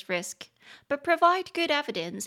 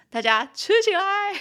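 high, loud, laughing cheer; jokingly rally the whole crowd; gleeful mock call to action; playful rallying cry; 11 semitones higher, 8 dB louder than usual. Jokingly urges everyone to take it.

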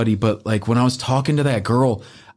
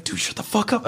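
urgent whispered aside to a friend; whispering to a buddy to stop talking; hushed warning; 11 semitones higher, 5 dB quieter than usual. Retold or imitated. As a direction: whisper it fast so he won't hear.

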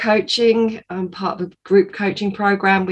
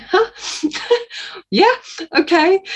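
high, laughing huh of disbelief; baffled laugh; 11 semitones higher than usual. Retold or imitated. Retelling her own baffled reaction to the prophecy, laughing high in disbelief. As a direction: pitch way up in puzzled amusement.

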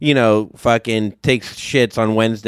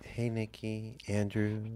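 very soft hushed greeting; imitating the soft ASMR greeting; 3 semitones lower, 17 dB quieter than usual. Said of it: "imitating the ASMR greeting".

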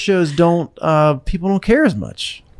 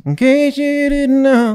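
slow, higher nasal drawl; nasal singer imitation; 11 semitones higher, slower than usual. Shown as a demonstration. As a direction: sing it through the nose.

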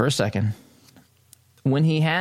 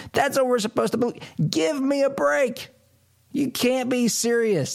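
incredulous mockery; 11 semitones higher than usual. Real, not acted. Mocking disbelief at Biden claim.

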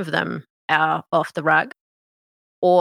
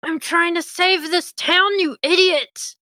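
higher, exasperated scolding; angry woman; 12 semitones higher, 3 dB louder than usual. Acted. Chanting woman furiously scolds the intruders, high and sharp. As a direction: snap at them for ruining everything.

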